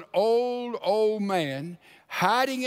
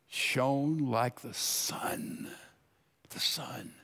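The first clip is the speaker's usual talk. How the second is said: awed whisper; 7 semitones lower, 8 dB quieter, much slower than usual. Acted. Awe at vision of Jesus.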